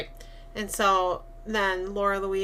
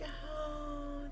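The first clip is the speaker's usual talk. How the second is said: high, soft, tender oh; a gentle smile into the word; soft smiling oh; 7 semitones higher, 15 dB quieter than usual. Retold or imitated. Rosemary smiling at Roman, soft tender oh. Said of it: "smiling at him".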